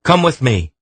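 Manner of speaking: high, loud command; urgent order. A narrator's reading. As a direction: order him along, no time to lose. Garion ordering the eunuch urgently.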